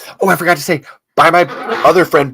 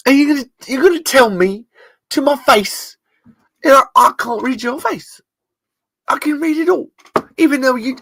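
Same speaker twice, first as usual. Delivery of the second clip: much higher, pushed accusing detective rant; Lightman impression; 8 semitones higher than usual. Host closing the podcast as Lightman grilling the room, high and hammed. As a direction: grill the room like a face-reading interrogator. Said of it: "I'm gonna do a Lightman impression".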